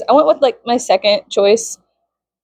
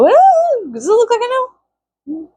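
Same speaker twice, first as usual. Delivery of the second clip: high mock exasperation; 14 semitones higher than usual.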